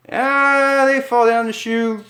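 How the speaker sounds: very high loud scream; falling scream. Acted. Couple falling down the chute, very high loud scream. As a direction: yell as you drop.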